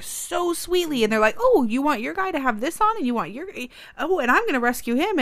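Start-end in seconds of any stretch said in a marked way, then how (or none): 1.4-5.2 put-on high sing-song child at play; eager, swooping little-kid chatter; sweet playing kids. Mom imitating kids playing G I Joes, sweet and excited.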